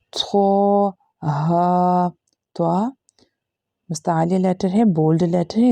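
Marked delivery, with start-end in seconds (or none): 0.1-2.1 थो and हा each held with a standing zabar; demo reading. Shown as a demonstration. Teacher models how students should read it. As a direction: read थो, हा separately, vowels stretched. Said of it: "तो हमें कैसे पढ़ना है?".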